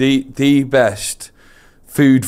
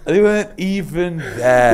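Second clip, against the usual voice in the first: helpless, slow laughing delivery; 7 semitones higher, slower than usual. Real, not acted. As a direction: say it through laughter, high and drawn out. Cracking up over his dry eyes.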